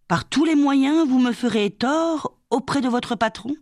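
high-pitched, challenging question; indignant challenge. Acted. Violette challenges Madeleine over her threats, high and indignant. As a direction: throw the accusation back, pitched well up.

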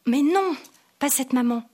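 put-on cutting in loud and high, irritated child; annoyed boy interrupting his father, loud and high; annoyed interruption. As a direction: snap back at the adult, exasperated. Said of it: "il m'a coupé, agacé".

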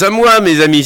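high, loud call for help; crying out. A narrator's reading. Penellan calling his friends from the snow tunnel, loud high cry. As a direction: shout up through the hole to his friends. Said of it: "s'écria-t-il".

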